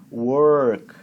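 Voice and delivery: "Work" drawn out slowly on a heavy curled R; tongue pulled back. Shown as a demonstration. Teacher modelling the R for a student, long curled R. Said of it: "bem puxada lá pra trás".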